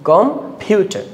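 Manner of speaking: Computer slowly split as com, then a high stressed PYU-ter; stress on second syllable. Shown as a demonstration. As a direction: break computer after the first syllable and push the second. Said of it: "আমরা second syllable পাচ্ছি".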